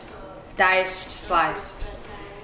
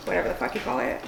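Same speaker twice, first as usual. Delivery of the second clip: muttered aside; 8 dB quieter, much faster than usual. Real, not acted.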